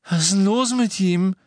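high, loud, startled question; alarmed croak. A narrator's reading. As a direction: blurt it out in fright. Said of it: "krächzte Fred mit erschrockener Miene".

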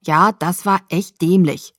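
slow, emphatic, full-voiced rebuke; reproachful agreement. A narrator's reading. Jacob agreeing with Bella about her stunt, emphatic reproach. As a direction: lean on each word as you agree. Friend scolding her after the rescue.

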